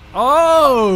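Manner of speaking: high, loud drawn-out cry; excited shout. Real, not acted. Player reacting to a goal, excited shout.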